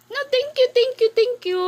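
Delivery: high, bright voicing for the toddler; high child voice. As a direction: speak for the baby in a high squeaky voice. Mother voicing child's thanks.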